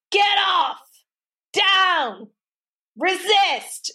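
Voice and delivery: high, barked commands like a drill sergeant; military commands. Retold or imitated. Dog trainer commanding the attacking dog, mock military bark. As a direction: shout it like army orders at a dog. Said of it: "American military terms".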